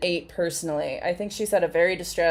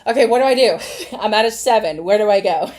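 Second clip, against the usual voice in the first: mock panic; 4 semitones higher, 8 dB louder, faster than usual. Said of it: "I'll pull this out and be like".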